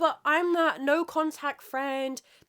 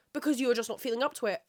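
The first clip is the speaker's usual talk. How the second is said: ordinary delivery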